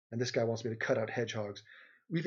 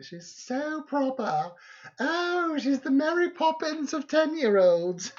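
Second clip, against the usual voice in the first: very high, drawn-out proper voice; exaggerated prim child impression, loud and high; Izzy; 13 semitones higher, 6 dB louder, much slower than usual. Imitator mocking a proper ten-year-old, very high prim voice. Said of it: "Nobody can imitate Izzy".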